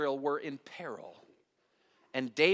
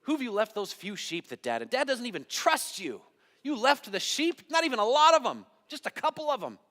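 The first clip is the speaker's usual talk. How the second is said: high-pitched mocking older brother; belittling dig; 7 semitones higher, 5 dB louder than usual. Older brother digging at David over the sheep, belittling. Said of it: "Did you get that little dig?".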